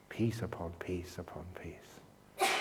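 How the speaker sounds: soft, low, slow repetition; almost whispered, each word savoured; hushed reverence. Real, not acted. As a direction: let it sink down slowly and softly. Savouring the word peace softly.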